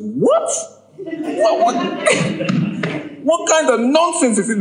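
high squeaky disbelief with a laugh; can't believe what he's hearing; squealing what, what, then a laugh; shocked outcry. Retold or imitated. Shocked reply to the Holy Spirit.

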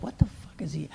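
hushed unvoiced what the fuck; whispered disbelief. Comic hearing his props used, whispering in disbelief. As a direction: whisper it in shock backstage.

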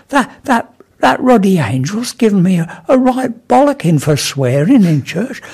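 high, stammering indignation; agitated complaint. Acted. Enoch complains to vicar about the angel's telling-off, high and flustered. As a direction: splutter it out high and aggrieved.

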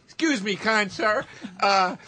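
high-pitched, exaggerated courtesy; overpolite pleading. Lost driver asking a stranger for directions, overpolite. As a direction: plead sweetly, rising on the please.

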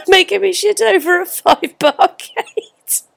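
high, laughing read-out; giggling disbelief at the message. Real, not acted. Laughing while reading message.

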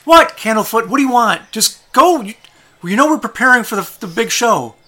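high, loud exasperated yelling; fed up with the silent guard; frustrated clown shouting. Acted.

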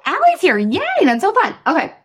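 normal talking voice

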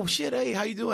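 high, breezy covering hello; mock-casual hello while clueless; faked recognition. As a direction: pretend you know them. Fake recognition greeting.

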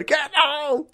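very high drawn-out cheer; crowd yell. Acted. Golf crowd going wild for Jamie, high drawn-out yell. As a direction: holler like a golf spectator. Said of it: "And everyone's going".

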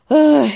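loud weary grunt into the mic; sudden loud "ugh". Real, not acted. Podcaster resumes her recap, loud involuntary grunt. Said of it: "I just grunted in the, in the mic".

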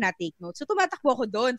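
ordinary delivery

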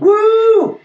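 high, loud whoop; excited cheer. Real, not acted. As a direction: cheer like at a party. Cheering the topic.

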